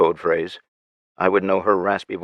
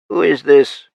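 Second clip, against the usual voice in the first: wary, higher and louder challenge; suspicious; 7 semitones higher, 7 dB louder than usual. A narrator's reading. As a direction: test the stranger with suspicion. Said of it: "Anthony asked suspiciously".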